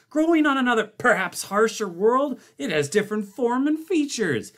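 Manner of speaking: high, fast indignant lecturing; indignant scientist. A narrator's reading. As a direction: rattle it off, outraged. Blair's heated defence.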